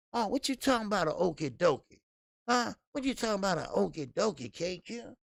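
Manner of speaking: high, incredulous what-you-talking-about; mock disbelief. Teasing a chatter with high mock disbelief. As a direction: play it up like you can't believe it.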